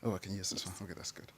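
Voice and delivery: whispered aside. Real, not acted. Aside while setting up slides.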